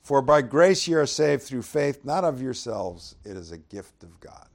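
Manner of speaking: reading the key verse to the congregation, proclaiming; scripture reading. Acted. Reads verses eight, nine.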